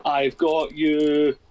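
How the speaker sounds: ordinary talk, nothing marked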